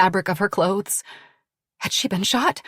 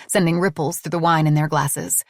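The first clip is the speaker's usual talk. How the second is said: ordinary delivery, nothing marked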